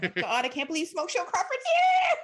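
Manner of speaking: high, loud starstruck shout; mock fan squeal. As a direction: squeal like a fan spotting a celebrity. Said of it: "I'm sure they were like".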